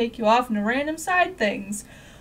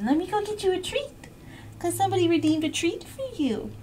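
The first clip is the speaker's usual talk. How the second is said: squeaky dog talk; 6 semitones higher, 4 dB quieter than usual. Real, not acted. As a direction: coo at the dog.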